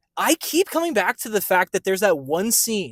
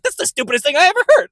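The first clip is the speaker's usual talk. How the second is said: high, loud, fast exasperated imitation; hammed-up emotive retort; mock outburst; 9 semitones higher, 6 dB louder, faster than usual. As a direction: blurt it like an over-the-top anime character. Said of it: "he actually does get emotive".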